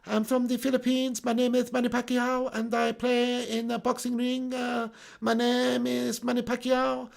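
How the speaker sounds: put-on quiet, measured Filipino-accented boxer; Manny Pacquiao. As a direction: attempt a Filipino accent on one held pitch. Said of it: "trying to think of how Manny Pacquiao sounds".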